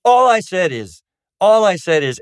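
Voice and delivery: high, loud insistence on each restart; defensive protest. Retold or imitated. Counseling client defending their words to the counselor, defensive protest. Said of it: "someone say in counseling".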